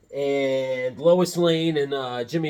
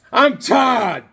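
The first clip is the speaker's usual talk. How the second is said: higher, louder character announcement; hammed-up character voice; 6 semitones higher, 6 dB louder than usual. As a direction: belt it out as the toy character. Acting out the character Todd.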